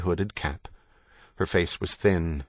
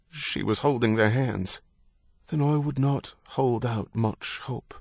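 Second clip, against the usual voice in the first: higher-pitched, lilting priest voice; Irish priest, consoling; 5 semitones higher than usual. A narrator's reading. Priest telling a husband there is little hope, consoling. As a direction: offer grave sympathy as an Irish priest.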